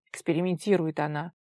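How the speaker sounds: low, grudging grumble; disgruntled grumble. A narrator's reading. Tigir complains about her theory, disgruntled low grumble. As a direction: grumble it under his own steam. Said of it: "недовольно буркнул Тигир".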